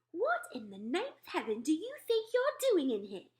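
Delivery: a maid snapping at an intruder; angry shout. A narrator's reading. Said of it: "she shouted angrily".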